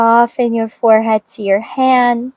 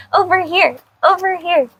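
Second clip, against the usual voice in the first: calling the dog from across the room, pitched up; calling from far away; 7 semitones higher than usual. Real, not acted. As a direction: call out, higher, to bring the dog over.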